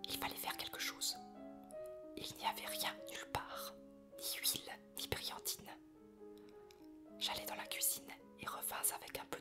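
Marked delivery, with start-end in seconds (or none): none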